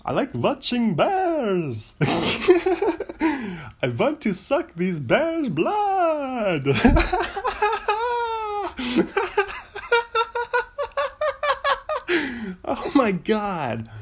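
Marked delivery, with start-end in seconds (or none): 0.0-6.7 put-on hammy, high-swooping vampire accent; over-the-top mock Transylvanian count, drawing out words; Dracula voice. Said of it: "All right, here it is". 6.7-12.6 long, high-pitched laughing fit; real wheezy cackling, unable to stop; helpless laughter. Real, not acted. Cracks up at own Dracula bit.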